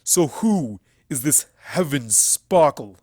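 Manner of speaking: loud, slow stallion voice; a hard, slow frowning question; frowning demand. A narrator's reading. Stallion demanding from Twilight, frowning. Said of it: "he frowned".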